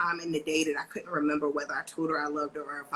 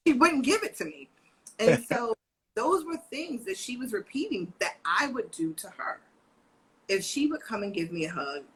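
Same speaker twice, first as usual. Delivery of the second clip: ordinary talk, nothing marked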